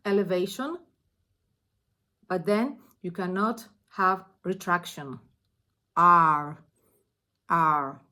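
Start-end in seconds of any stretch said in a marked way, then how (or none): 6.0-8.0 "R" pushed low and loud from the tongue base; strong back-tongue "R". Shown as a demonstration. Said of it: "work a lot on the base".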